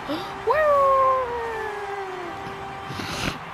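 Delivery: sharp high gasp of surprise; surprised gasp. Real, not acted. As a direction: gasp in shock. Surprised gasp while gaming.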